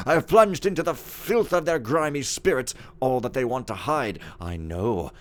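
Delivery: aggrieved steward venting about servants, lively swings in pitch; haughty, scornful steward. Acted. Steward complaining in his journal about servants, haughty and indignant. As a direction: rant like a pompous, disgusted head servant.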